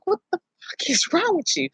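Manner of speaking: hissed whisper, breathy; fierce whisper. Retold or imitated. Whispering furiously at her husband in the car. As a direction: whisper it in fury. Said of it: "I'm like".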